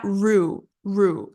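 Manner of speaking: RU said alone as "roo"; isolated R sound. Shown as a demonstration. Teacher isolates the missed syllable, RU as a clear roo. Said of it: "that R sound".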